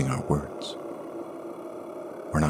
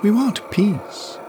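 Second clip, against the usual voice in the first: very high, otherworldly voice; high strange hiss of a yes; eerie creature woman; much slower than usual. A narrator's reading. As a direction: make her sound unnatural. Acid woman speaks.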